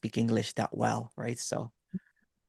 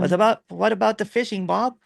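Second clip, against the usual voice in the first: higher, louder hammed-up Canadian accent; mock Canadian accent; 9 semitones higher, 6 dB louder than usual. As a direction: play a jolly over-the-top Canadian accent while drinking. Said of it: "trying to talk like Canadian".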